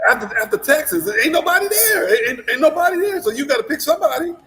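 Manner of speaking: high, loud, fighting back laughter; laughing shout. Real, not acted. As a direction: shout it while trying not to laugh. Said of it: "I'm trying not to laugh with you".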